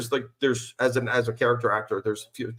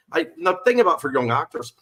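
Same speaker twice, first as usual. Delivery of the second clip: ordinary talk, nothing marked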